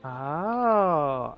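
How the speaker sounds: normal talking voice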